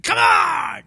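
full-throated shout from the back; loud heckler yell; heckler scream. Retold or imitated. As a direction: bellow it like a rowdy heckler.

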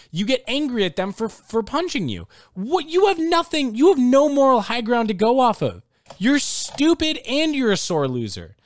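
high, loud, furious rant; angry rant. Real, not acted. Ranting at Chase Claypool about his taunts, furious and loud. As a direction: let the outrage boil over.

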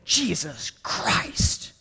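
a hint of someone swearing under the breath; lightly voiced careless swearer; mock cursing. Shown as a demonstration. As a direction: toss the holy name off as a curse. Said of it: "reduce it to a curse word".

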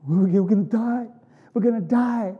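half-acted panic at the shore; mock panic. Israelites panicking at the sea.

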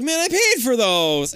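high, loud whiny complaint; indignant protest. Retold or imitated. Boy protesting his glasses being thrown away, high whine. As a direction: protest the lost money loudly. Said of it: "which he's like".

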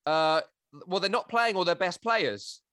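loud, high fake phone chat with a mate; hammed-up loud phone call so the scout hears. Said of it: "pretended to take a phone call".

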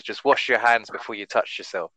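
ordinary talk, nothing marked

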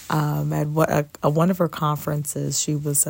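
ordinary delivery

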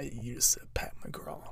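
slow, whispered gloating; mock whisper. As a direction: hiss it slowly, smug and secret. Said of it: "he's like".

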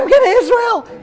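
very high mock squeal of joy; excited shriek. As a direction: cut in with a thrilled, squealed announcement. Wife's excited scream acted again.

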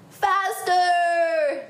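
high, drawn-out shout; excited yell. Acted. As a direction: urge the horse on. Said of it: "yelled Lucy".

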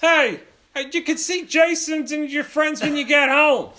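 high, loud calling voice; exasperated dad. Acted. Dad carrying juice calls his son, loud mock exasperation. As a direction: summon your kid as a hammed-up fed-up dad.

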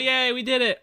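high, loud hurrah; celebratory cheer. Real, not acted. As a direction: cheer loudly for the milestone. Joins the celebration.

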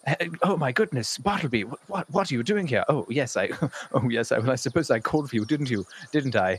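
flustered high-pitched delight, bursting out; ecstatic. Acted. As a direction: gush and stumble, overjoyed to see an old pet. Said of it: "Lawrence is ecstatic".

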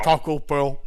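mock talking with mouth full; muffled as if chewing. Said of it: "mouth full".

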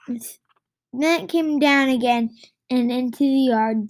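plain reading voice

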